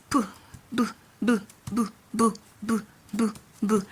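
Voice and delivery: b as a short isolated "bu"; B sound demo. Shown as a demonstration. Teacher showing how the B letter is pronounced, B sound demo. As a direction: repeat b as separate "bu" syllables. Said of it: "it's pronounced".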